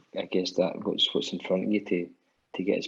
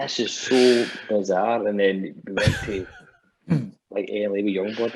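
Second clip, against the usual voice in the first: normal talking voice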